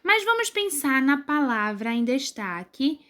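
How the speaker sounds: ordinary delivery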